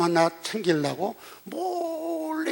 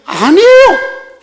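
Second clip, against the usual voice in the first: high, long-drawn shout; emphatic denial; 13 dB louder, much slower than usual. Acted. Preacher answering his own question about Gideon, emphatic denial. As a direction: belt out the denial, stretching it high.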